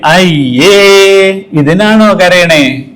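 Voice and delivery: drawn-out slow mocking ayye; mock-dismissive adult to crying child; dismissive scoff. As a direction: belittle the tears, slowly.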